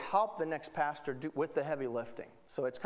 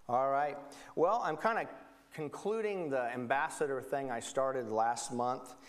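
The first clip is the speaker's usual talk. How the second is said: ordinary delivery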